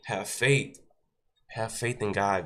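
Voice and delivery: normal talking voice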